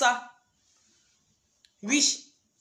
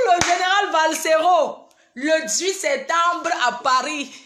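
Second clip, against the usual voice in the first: concert announcement; 3 semitones higher, 4 dB louder, slower than usual. Acted.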